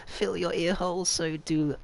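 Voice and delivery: mock seductive, drawn out; sultry voice. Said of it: "You have my sultry voice".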